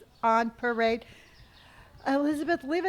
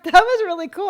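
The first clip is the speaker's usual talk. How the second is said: excited; 7 semitones higher, 11 dB louder, faster than usual. Real, not acted. Delighted by a gift.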